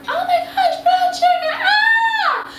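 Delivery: very high, gushing squeal of delight; excited squeal. Acted. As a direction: squeal over the brown sugar. Gushes over brown sugar.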